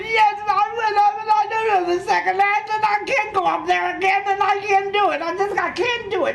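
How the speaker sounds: high, loud, near-crying despair; a high, loud tearful wail; tearful pleading. Retold or imitated. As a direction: plead through tears that you can't go on. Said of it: "And I said".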